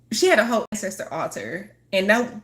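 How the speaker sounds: ordinary delivery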